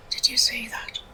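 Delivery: hushed, breathy aside; sneaky whispered question to the daughter. Retold or imitated. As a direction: whisper it so nobody else hears. Whispering to daughter during healing.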